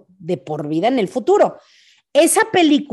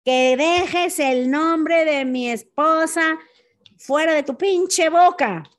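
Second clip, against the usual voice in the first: grito fingido, agudo y lento, sin subir volumen; grito imitado; 6 semitones higher, slower than usual. Retold or imitated. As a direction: imita un reclamo gritado arrastrando las palabras. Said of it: "le grita". (falso grito de enojo, contenido y agudo)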